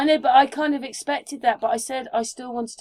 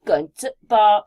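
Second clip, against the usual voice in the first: mock broken English; 6 dB louder, slower than usual. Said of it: "I remember people talking like that".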